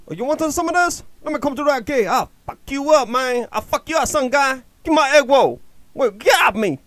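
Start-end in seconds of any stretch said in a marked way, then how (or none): none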